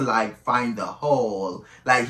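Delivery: usual speaking voice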